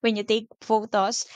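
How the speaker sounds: usual speaking voice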